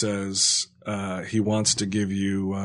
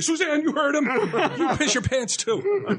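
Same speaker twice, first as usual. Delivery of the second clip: very high, hammed-up bossy order; mock angry orderly. Imagined orderly ordering patient's partner, mock angry voice. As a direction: turn the comic threat on a bystander.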